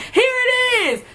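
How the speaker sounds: high, drawn-out gotcha announcement; teacher proudly proving the student wrong, slowly; mock triumphant teacher. Teacher finds the glaze.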